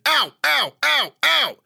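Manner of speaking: short, very high, loud bursts; yelled order. A narrator's reading. Guard hurrying the narrator out, yelling repeatedly. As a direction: fire off each word as a separate shout. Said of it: "and yelled".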